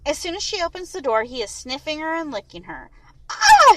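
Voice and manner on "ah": high, loud shriek breaking into a laugh; laughing scream. A narrator's reading. Mia greeted by a jumping dog, laughing scream. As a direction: squeal with delight as the dog jumps. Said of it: "she screams and laughs at the same time".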